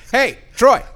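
high, loud holler across a field; imitated mom's yell. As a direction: call out like someone's mom. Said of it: "your mom yelled at me".

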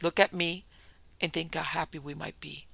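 ordinary delivery

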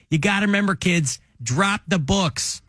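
mock cop addressing schoolkids; Chicago cop impression; J J Bittenbinder. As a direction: drive the advice home, stretched out. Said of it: "he would say".

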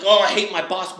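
loud, high mock groan about the boss; mock grumbling. Griping to himself about his boss, hammed-up groan. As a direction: ham up the boss complaint.

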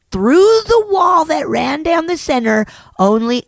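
rough, worn-out voice; hoarse voice. Real, not acted. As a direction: voice gone hoarse after screaming. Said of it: "My voice is gone".

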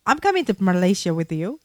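normal talking voice

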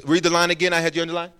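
normal talking voice

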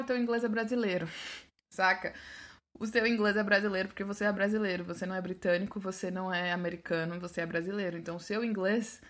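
ordinary talk, nothing marked

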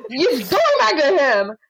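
high, slow, emphatic mock dismissal; drawn-out, high-pitched fed-up declaration; retold exasperated telling-off. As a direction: act out handing him off, amused underneath. Acts out telling off client.

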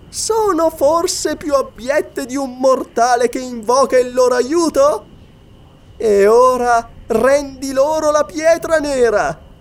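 high-pitched gloating witch voice; taunting witch. A narrator's reading. Atla, the witch, retorts and demands. (voce acuta e beffarda da strega)

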